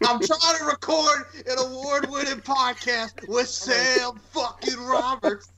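high, slow indignant whine; mock outrage. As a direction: drag it out in high pretend indignation. Mock outrage about podcast.

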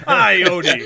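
high, booming mock hail; mimicking loud call. Game master teases player for speaking aloud, mock hail. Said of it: "he just said that out loud".

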